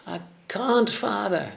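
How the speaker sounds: put-on high, young boy's voice; little boy voice. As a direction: sound like a small child who gave up. Boy Peter fails to pull tree.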